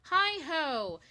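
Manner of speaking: high, loud cheery "Hi ho"; stranger's greeting. Retold or imitated. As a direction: chirp it like a stranger in a coffee shop. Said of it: "someone says".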